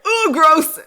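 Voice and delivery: loud, high "gross"; disgusted. Retold or imitated. Reacting to cutesy characters, loud disgusted outburst. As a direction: blurt out disgust. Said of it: "I would just be like".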